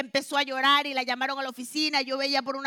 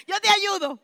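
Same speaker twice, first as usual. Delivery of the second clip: higher and louder, laughing through the words; laughing offer; 8 semitones higher, 8 dB louder than usual. Retold or imitated. To her humiliated coworker, a laughing offer to help. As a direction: chuckle as you offer help.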